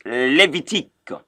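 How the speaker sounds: Levitique said "lay-vee-TEEK"; Levitique with a crisp French -teek ending; French pronunciation. Shown as a demonstration. Teacher models it for Anglophones, Levitique as "lay-vee-TEEK". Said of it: "that's how you pronounce it".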